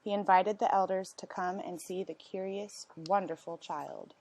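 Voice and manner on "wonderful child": ordinary delivery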